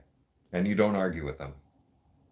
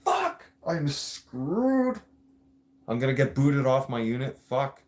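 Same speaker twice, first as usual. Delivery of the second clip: panicked; 4 semitones higher than usual. Retold or imitated. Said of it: "I was like".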